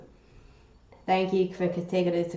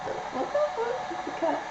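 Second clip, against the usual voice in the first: startled squeal; 7 dB quieter than usual. Real, not acted. Startled at cat on fence.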